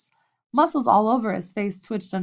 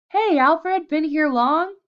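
calling out; 8 semitones higher, 7 dB louder, slower than usual. A narrator's reading. Henry calling out across the gym.

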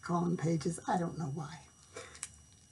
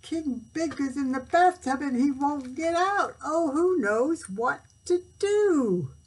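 higher, louder frantic plea; panicked page; 7 semitones higher, 6 dB louder than usual. A narrator's reading. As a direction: wail it in despair. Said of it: "cried the page".

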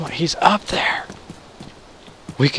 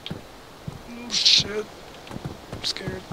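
soft, scared whisper; 9 semitones higher, 5 dB quieter, much slower than usual. Real, not acted. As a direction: whisper it, frightened. Hiding from shooter.

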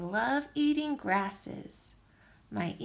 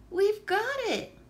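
put-on cheerful kids' shout, higher and louder; excited group cry; 9 semitones higher, 4 dB louder than usual. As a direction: cry it out like a class that solved the riddle. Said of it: "they cried".

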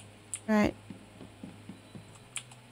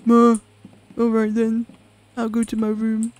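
lightly voiced sulky little guy; a hint of a droopy, bored mumble; mopey character voice; 4 dB louder than usual. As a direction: shrug it off like a mopey kid.

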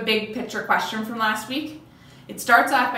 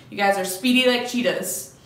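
normal talking voice

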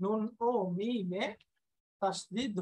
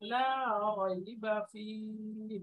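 slow, deliberate recitation; slow careful Quran reading; 4 dB quieter, slower than usual. Shown as a demonstration. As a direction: recite each sound with care, slowly. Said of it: "এভাবে খেয়াল করে করে".

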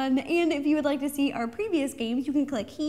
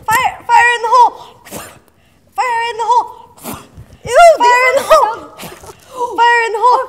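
high, loud laughing yell; 8 semitones higher, 15 dB louder, faster than usual. Real, not acted. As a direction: shout it as you spit. Spitting out marshmallows, yelling.

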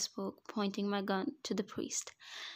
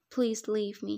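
louder, mildly urgent plea; pleading; 5 dB louder than usual. A narrator's reading. Beaten man begs the devil for release, pleading. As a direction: beg to be let go. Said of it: "he pleaded".

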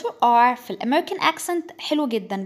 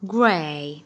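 American accent; 7 semitones lower than usual. Shown as a demonstration. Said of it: "ده British وده American".

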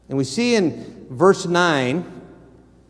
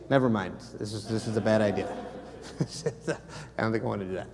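laughing aside; 6 semitones lower, 9 dB quieter than usual. Real, not acted. Joking aside about fasting.

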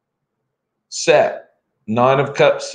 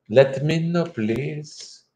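higher-pitched attempt at an Australian-English accent; put-on Australian English accent; 5 semitones higher, 4 dB quieter, slower than usual. Shown as a demonstration. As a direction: have a go at Australian English, trailing off softer. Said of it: "working on my Australian English thing".

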